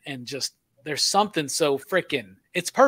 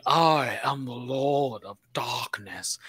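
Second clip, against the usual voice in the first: slow, lower sinister demon-lord voice; Tim Curry voice; 4 semitones lower, 4 dB quieter, slower than usual. Retold or imitated. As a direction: draw it out low like a dark lord. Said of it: "that Clue Butler voice".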